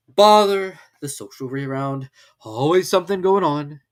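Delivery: put-on mope as the gloomy old donkey. Eeyore sulking.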